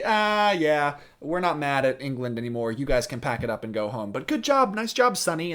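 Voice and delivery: mock hearty dismissal, high on the praise; patronizing cardinals. Cardinals sending the young prince home, mock patronizing. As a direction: brush him off like a little kid, loud and offhand. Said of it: "they pat him on the head".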